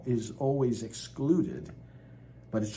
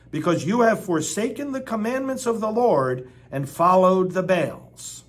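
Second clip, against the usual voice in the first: loud, high, proclaiming the verses; forceful scripture reading; 5 semitones higher, 6 dB louder than usual. Acted. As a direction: thunder the text at the congregation. Preacher reads Ahab and Elijah.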